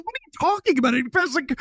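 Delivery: very high-pitched incredulous protest; squeaky-high mock indignation; retold indignation. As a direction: replay your outrage, voice shooting way up. Retells indignant reply to rejection.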